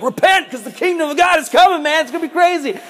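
high-pitched, frantic street-prophet cry; wild desert preacher. Acted. John the Baptist preaching in the desert, wild high cry. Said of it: "he's like".

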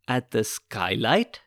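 ordinary delivery, nothing marked

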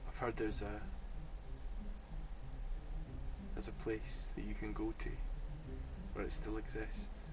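hushed noir shopkeeper; speakeasy mystery. Retold or imitated. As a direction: whisper a secret legend. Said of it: "New York speakeasy".

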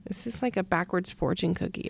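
fast whispered aside; quick whisper. Real, not acted. Host whispering a quick aside about the question card. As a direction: whisper it quickly, half to yourself.

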